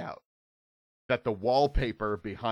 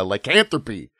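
usual speaking voice

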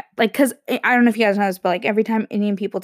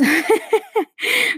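high laugh; 13 semitones higher than usual. Real, not acted. Laughs at own impression.

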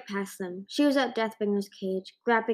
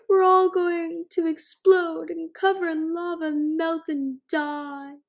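sobbing wail; 8 semitones higher, 4 dB louder, slower than usual. A narrator's reading. Panicked dragon keeps sobbing.

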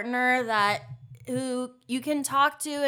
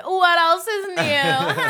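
laughing; 7 semitones higher, 9 dB louder, slower than usual. Real, not acted. Laughing repeat.

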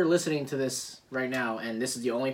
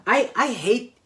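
loud, heated rant; raised voice, higher and indignant; mad; 3 semitones higher, 6 dB louder than usual. Real, not acted. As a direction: vent real hatred of a rival team. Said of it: "I'm mad about".

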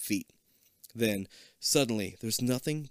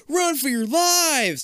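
high, loud, slow shout; panicked shout; 9 dB louder, slower than usual. Acted. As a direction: yell for everyone to flee. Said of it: "you cry".

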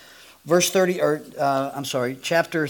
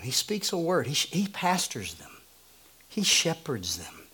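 usual speaking voice

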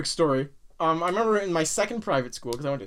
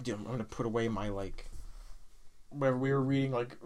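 mock hushed classmate; 9 semitones lower, 11 dB quieter than usual. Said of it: "and it would be like".

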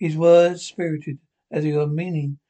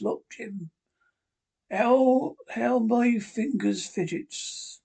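put-on slow, higher pleading old sailor; 6 semitones higher, much slower than usual. A narrator's reading. Captain shows Jim his shaking fingers, slow pleading. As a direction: beg the boy to look, dragging it out. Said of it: "he continued in a pleading tone".

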